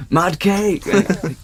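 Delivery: high, loud playful yell; mock yell. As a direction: yell it like a rowdy band. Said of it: "we were like".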